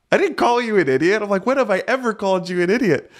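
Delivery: high, protesting disbelief at the accusation; indignant denial. Retold or imitated. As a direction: push back in a high, wounded tone. Husband denies insult to wife.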